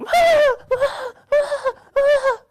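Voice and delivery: very high loud panicky ahs; frantic high cries; panicked ah. Retold or imitated. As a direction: squeal in cartoon panic. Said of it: "we're like".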